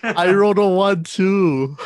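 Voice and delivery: excited outburst. Real, not acted. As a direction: blurt it out, thrilled at the coincidence. Dice roll, both rolled a one.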